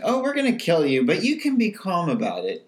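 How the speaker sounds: nonchalant. Retold or imitated. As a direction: make killing sound casual and pleasant. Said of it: "being so nonchalant about the whole thing".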